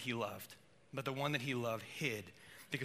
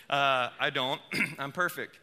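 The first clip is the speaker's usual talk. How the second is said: ordinary talk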